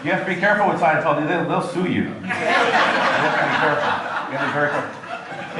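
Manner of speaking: laughing. Real, not acted. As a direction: joke on while still chuckling. Jokes on, still laughing.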